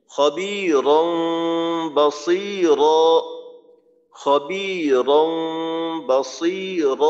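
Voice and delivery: slow chanted খবিরন বসির with the nasal held long; Quran recitation. Shown as a demonstration. Teacher reciting the verse for students, slow chant. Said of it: "এই উচ্চারণটা হবে".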